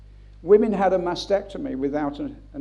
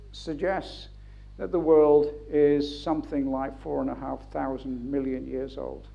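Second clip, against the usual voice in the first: ordinary delivery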